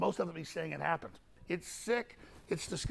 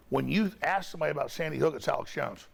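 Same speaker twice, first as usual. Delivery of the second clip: usual speaking voice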